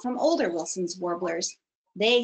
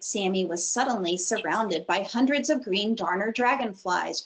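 usual speaking voice

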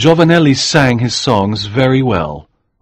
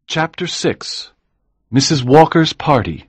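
chapter title announcement; 7 semitones higher than usual. A narrator's reading. Chapter heading read.

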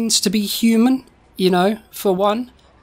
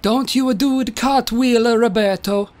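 put-on slow, higher Italian old man; Da Vinci voice; 3 semitones higher than usual. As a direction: plead slowly in a put-on Italian accent. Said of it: "Da Vinci's like".

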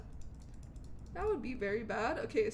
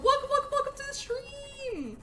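high, lilting welcome; sing-song welcome; 12 semitones higher than usual. Pretend. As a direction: greet them in a bright sing-song.

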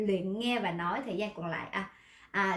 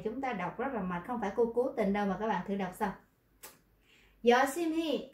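usual speaking voice

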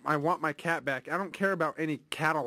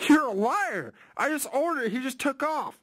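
indignant outburst; 7 semitones higher than usual. Pretend. Prank caller accusing the rental clerk.

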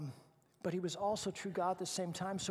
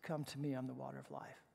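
soft quote of God; 5 semitones lower, 7 dB quieter than usual. Acted. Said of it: "And he says".

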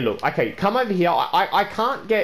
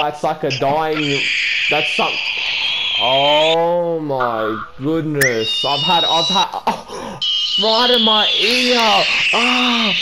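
pained loud groans; 4 dB louder, much slower than usual. Real, not acted. Gamer hurt by a loud sound in his ear, loud pained groans. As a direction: complain loudly at a sound in your ear. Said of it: "That's very nice for my, my ears".

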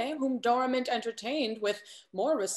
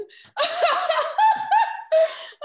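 a bright, high peal of laughter, much louder; burst of high laughter; 7 dB louder than usual. Acted. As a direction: laugh out high before the gossip. Belinda laughs before gossip.

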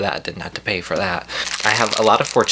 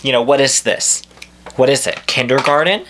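louder, higher incredulous questions; indignant; 5 semitones higher, 5 dB louder than usual. Real, not acted. Customer baffled by taped takeout bag, indignant. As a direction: demand answers in mock outrage.

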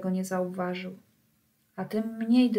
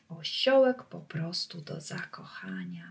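hushed, softer and lower aside; fond whisper; 4 semitones lower, 4 dB quieter than usual. A narrator's reading. Narrator gushing over the dressed-up donkey, whispered. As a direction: whisper it as if sharing a delight.

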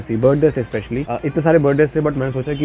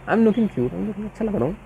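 high-pitched, cutesy self-admiration; playful preening; 8 semitones higher than usual. Pretend. Vlogger admiring own look on camera, playful high voice.

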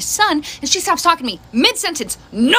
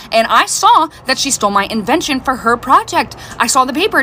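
normal talking voice

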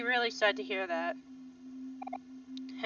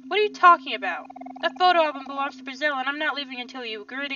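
raised, higher voice, arguing back; heated protest; 3 semitones higher, 6 dB louder than usual. Acted. Kel arguing with Aubrey over the photo album, heated protest. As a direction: push it out loud in protest.

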